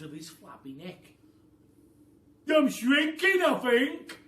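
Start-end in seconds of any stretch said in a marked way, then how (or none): 2.5-4.2 high, loud, babbling panic; burbling Mr Twit. A narrator's reading. Mister Twit realising he is shrinking, burbling in alarm. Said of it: "burbled Mister Twit".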